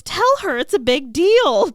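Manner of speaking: high, loud, insistent appeal; Willow. Retold or imitated. Willow urging Giles to back her up, insistent. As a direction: press the point, voice jumping up.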